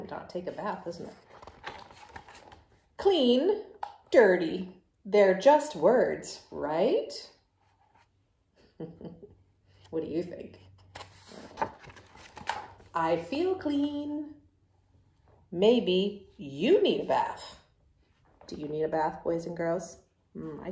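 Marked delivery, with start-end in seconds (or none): 3.0-7.1 loud, brash pigeon voice, brushing it off; the pigeon, loud and sure of itself, to the kids; cocky pigeon. Acted. Pigeon arguing against bath. 12.9-17.6 loud, brash pigeon voice, brushing it off; the pigeon, loud and sure of itself, to the kids; cocky pigeon. Acted. Pigeon insisting it is clean.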